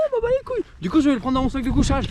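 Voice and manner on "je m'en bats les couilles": much higher cheeky dog voice. Retold or imitated. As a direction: voice the dog not caring. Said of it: "Il reprenait".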